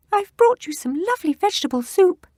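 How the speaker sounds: put-on high, loud girlish squeak; fake girl voice. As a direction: keep up the squeaky disguise. Wolf fakes girl voice.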